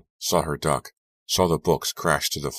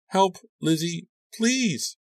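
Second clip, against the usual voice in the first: much higher, tearful plea; begging; slower than usual. A narrator's reading. Crying friend begs before the line dies, much higher. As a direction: beg weakly for help. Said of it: "she begged".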